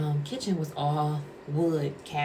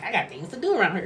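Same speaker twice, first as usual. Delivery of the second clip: higher, quick exasperated retort; retelling an irritated comeback; 5 semitones higher, 3 dB louder, faster than usual. Retold or imitated. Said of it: "so I'm irritated".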